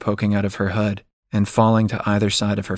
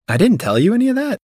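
high, fast disbelieving question; surprised; 13 semitones higher, faster than usual. Acted. Liv surprised at her own omission.

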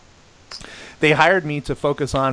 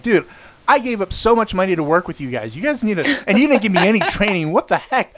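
higher-pitched mock protest; retold indignation; 6 semitones higher than usual. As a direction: object like a wronged employee, half amused. Retells indignant answer to firing threat.

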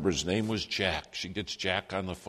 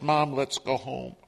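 higher, slow, upset pleading; retold tearful plea; 5 semitones higher, 4 dB louder than usual. Crying son to his fallen mom in the snow, retold tearful plea. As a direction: plead as if close to tears. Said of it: "I'm crying, she's crying".

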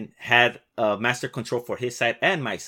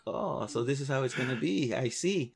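softer, wry sarcastic aside; dry realization; 7 dB quieter than usual. Retold or imitated. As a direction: let it dawn on you, quietly unimpressed. Realizing coworker pranked him.

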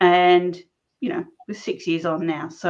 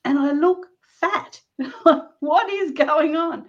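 higher, slow, put-on dismayed voice with a laugh in the middle; mock dismay; 8 semitones higher, slower than usual. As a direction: act out staring at yourself in disbelief, drawn out. Mock dismay at her swollen neck.

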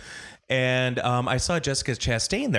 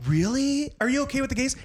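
higher mock question; 12 semitones higher than usual. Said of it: "I'm like".

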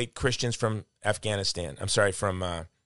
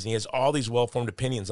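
usual speaking voice

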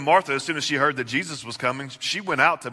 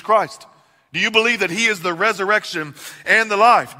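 full-force preaching; 5 semitones higher, 5 dB louder than usual. Acted. Preaching climax to congregation.

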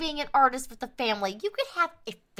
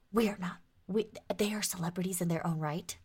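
hushed secret whisper; 6 semitones lower, 8 dB quieter than usual. Acted. Sister whispering the family secret to her brother. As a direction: lean in and whisper it.

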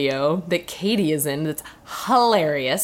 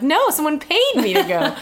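higher-pitched mock correction; playful retort; 8 semitones higher than usual. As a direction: blurt the comeback, amused at yourself. Acting out own retort.

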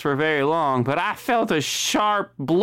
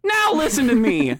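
a higher, louder put-on voice; horrible new voice; 6 semitones higher, 3 dB louder than usual. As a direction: show off the ruined new voice. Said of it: "I used to speak with a British accent".

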